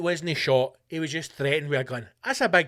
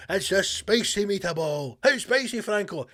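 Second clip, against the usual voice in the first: mock Italian; 4 semitones higher than usual. Mock Italian movie exchange.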